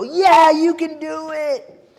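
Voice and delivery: roaring crowd. Retold or imitated. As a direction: bellow it like the whole crowd is sure. Crowd answering louder second time.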